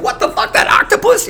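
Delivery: high, breathy astonished exclamation; excited disbelief. Retold or imitated. Cooks at the reunion dinner marvelling at octopus, high breathy exclaim. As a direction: gasp out amazement at the octopus. Ex-staff reacting to octopus dish.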